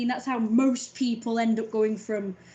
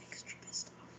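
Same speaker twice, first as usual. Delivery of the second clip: whispered repeat; 20 dB quieter than usual. Shown as a demonstration. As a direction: breathe it out with no voice. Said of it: "I'm extra pissed off".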